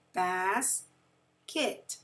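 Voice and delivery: "basket" slowly, with a clear gap between bas and ket; syllable break. Shown as a demonstration. Teacher drawing a line between syllables, "basket" pulled apart. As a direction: pause at the break inside "basket". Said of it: "that's where our syllable break".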